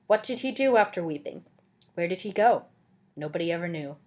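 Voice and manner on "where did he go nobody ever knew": usual speaking voice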